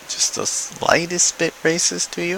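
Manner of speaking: high, soft mock-delicate questioning; sarcastic question. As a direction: lift the voice and soften it sarcastically.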